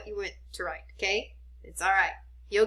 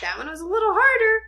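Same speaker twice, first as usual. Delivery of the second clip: excited teacher; 11 semitones higher, 6 dB louder, much faster than usual. Real, not acted. Teacher excited after tricky word.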